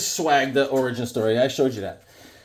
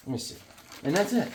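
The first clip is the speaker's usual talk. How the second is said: ordinary talk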